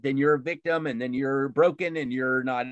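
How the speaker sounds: usual speaking voice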